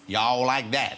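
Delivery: lightly attempted Southern farm drawl; Southern farm accent. Shown as a demonstration. Host tries out the farm accent, Southern. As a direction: try a little Southern US accent. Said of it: "a little bit Southerny".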